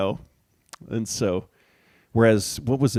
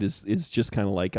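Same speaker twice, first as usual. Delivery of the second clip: ordinary delivery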